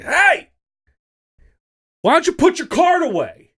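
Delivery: high, loud shouted scolding; angry yell. Retold or imitated. Dad yelling at a woman to return her cart, high and loud. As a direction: yell it across the parking lot. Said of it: "I yelled and I said".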